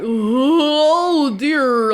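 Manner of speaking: a mocking, faintly put-on "oh dear" from the guard; lightly voiced indifferent guard. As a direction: say it like a guard who can't be bothered. Guard reacts to death.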